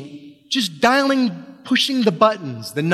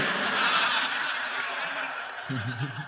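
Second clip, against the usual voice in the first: silent laugh; 4 semitones lower, 10 dB quieter than usual. Real, not acted. Laughs at own awkward speech.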